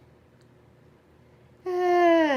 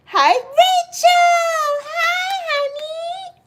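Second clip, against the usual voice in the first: very high sing-song greeting; much slower than usual. Real, not acted. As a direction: sing out a sweet hello.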